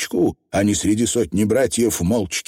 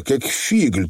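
ordinary delivery, nothing marked

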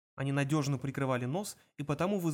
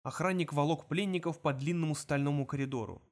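plain reading voice

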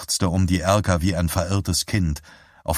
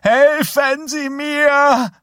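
high, loud shriek; calling for help; 6 dB louder, much slower than usual. Acted. Woman calling up the stairs, shrill cry for help. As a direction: scream for help from downstairs.

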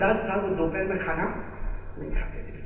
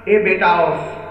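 calling out; 3 semitones lower, 7 dB louder, slower than usual. Acted. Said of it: "ماں نے آواز دی".

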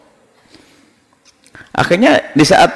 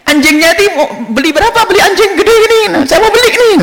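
feigned eagerness. Acted. Second con man pretends eagerness.

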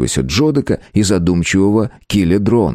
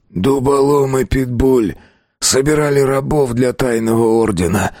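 hoarse croak; 6 semitones higher than usual. A narrator's reading. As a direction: croak it out with effort. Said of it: "прохрипел до этого молчавший Кровосток". (хрипло, медленно)

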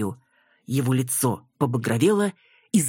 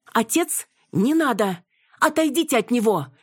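much higher, rising alarmed cry; sudden shout; 10 semitones higher than usual. A narrator's reading. Yana shouts at the fake doctor to stop. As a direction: scream to stop him in panic. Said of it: "вдруг закричала Цветкова".

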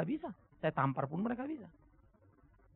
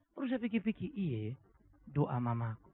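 usual speaking voice

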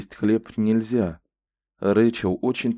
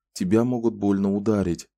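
plain reading voice

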